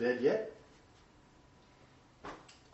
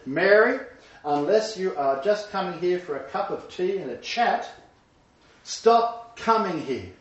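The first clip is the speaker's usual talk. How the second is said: firm, slow, raised insistent plea; mother's firm demand; 6 semitones higher, 5 dB louder than usual. Retold or imitated. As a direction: voice the mother putting her foot down. Mother voiced in retold story.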